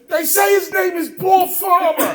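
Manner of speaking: high loud shout with a laugh; cop shouting back. Retold or imitated. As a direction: partner yells the name back, laughing. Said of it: "He says".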